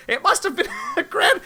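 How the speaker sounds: high rooster voice cracking into laughter. Acted. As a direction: start the grand rooster line, break up laughing. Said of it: "I'm gonna do this rooster voice".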